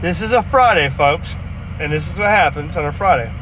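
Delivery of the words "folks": ordinary talk, nothing marked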